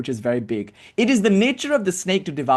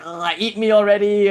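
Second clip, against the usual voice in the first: exasperated bird; 5 semitones higher, 5 dB louder than usual. Retold or imitated. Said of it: "and the bird is like".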